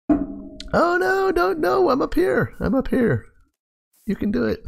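squeaky, high worried pleading. Real, not acted. Begging the parrot not to fly off, pitched high. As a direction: coax the bird in a high voice.

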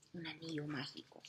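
fast secretive whisper; breathless whisper about the magic ring. Acted. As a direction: hush it like a secret treasure.

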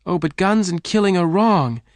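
high, distressed protest; crying out. A narrator's reading. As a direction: protest emotionally with the pitch lifted high. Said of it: "Jane cried".

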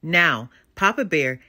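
ordinary delivery

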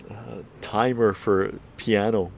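normal talking voice

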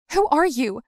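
high, loud frightened cry; kidnapped girl crying out in panic; panicked waking. Acted. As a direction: wake up terrified. Waking in captivity.

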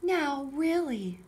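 lightly voiced mother bear chiding; Mama Bear. Mama Bear chiding her family, mild slow reproof. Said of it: "said Mama Bear".

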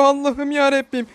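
high, frantic appeal to God; desperate cry to God. Real, not acted. Gamer crying out to God while chased, desperate. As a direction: cry out to heaven in despair.

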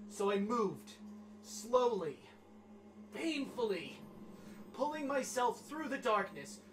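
grave, measured storyteller voice; dramatic diary reading. Acted. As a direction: read it like a grim survival diary. Reads climber's diary aloud.

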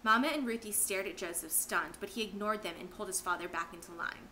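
ordinary delivery, nothing marked

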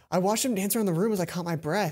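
ordinary talk, nothing marked

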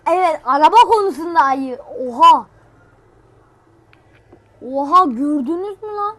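loud thrilled shouting; excited shout. Real, not acted. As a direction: shout at the viewers to look. Bear charges the car.

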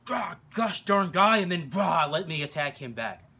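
loud, high, slow battle cry; mock fighting growl. Instructor voices the fighter who refuses to freeze, mock angry roar. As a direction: roar it like a fighter striking back. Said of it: "instead of".

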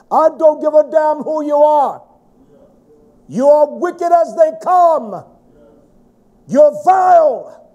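high, loud, slow preacher's roar; thundering slow rebuke from the pulpit; furious shout. Real, not acted. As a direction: hurl it at her like a condemnation. Preacher berating a wicked woman.

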